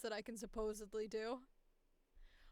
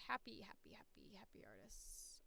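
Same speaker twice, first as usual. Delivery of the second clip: whisper; 5 semitones lower, 15 dB quieter than usual. Real, not acted. Reading name under breath.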